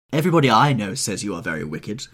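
put-on loud old-lady reproof; crying out. A narrator's reading. Old lady reproving Lord Henry, crying out. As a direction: insist on it loudly. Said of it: "cried the old lady".

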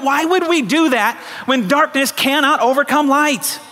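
rally the church with rising fervour; fiery preaching. Acted. Pastor challenging his congregation, fervent preaching.

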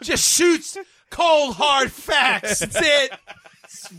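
high, loud, slow hammed-up pitch; mock dramatic pitch. Pitching a silly fact gun to friends, loud and slow. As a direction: sell it like a loud hammy ad.

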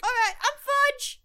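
little elf voice. Acted.

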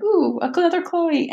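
high, excited little "ooh" of recognition; light up with high-pitched delight, retelling it; delighted reaction. Retold or imitated. Retells own reaction while reading.

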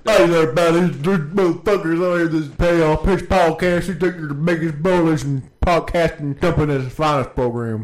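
taunting a rival podcast in a loud put-on Saint Louis accent. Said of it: "What the fuck is that accent?".

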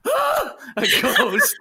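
high, slow mock-scared gasp with a laugh; mock group gasp. The crowd gasping at a ghost, mocked with a laugh. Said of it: "all these dickheads went".

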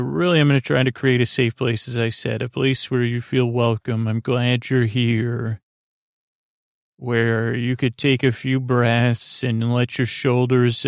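ordinary talk, nothing marked

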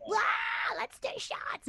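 put-on high, whooping party-girl squeal; mock party crowd. Singer mocking a rowdy cabaret audience, high party squeal. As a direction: squeal it like a tipsy hen party. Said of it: "everyone like".

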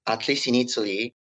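usual speaking voice

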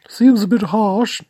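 indignant falsetto snap; high falsetto client. Acted. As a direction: fire it back in a squeaky falsetto. Client voiced again.

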